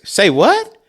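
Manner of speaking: hammed-up shocked father; dad's disbelief. Dad hears the dog plan, high mock disbelief. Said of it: "And my dad goes".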